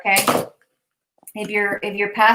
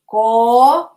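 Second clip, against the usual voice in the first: coño loud and rising at the end; emphatic coño; 6 dB louder than usual. Shown as a demonstration. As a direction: bark out coño, tail going up. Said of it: "Repeat after me".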